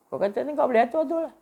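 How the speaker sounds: high, loud taunting dare; mock challenging rebuke. Preacher voicing a divine rebuke, high taunting dare. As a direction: throw the dare back mockingly.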